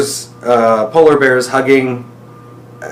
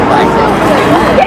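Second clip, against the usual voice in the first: excited bye; 8 dB louder than usual. Real, not acted. Excited goodbye after rings.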